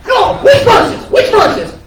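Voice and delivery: high-pitched, pestering questioner; insistent stage character. Retold or imitated. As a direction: demand an answer in a mock high voice. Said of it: "starts asking me".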